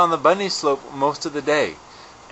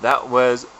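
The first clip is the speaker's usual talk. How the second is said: ordinary talk, nothing marked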